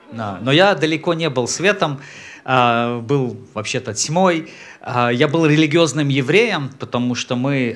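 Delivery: ordinary talk, nothing marked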